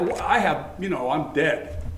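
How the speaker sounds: usual speaking voice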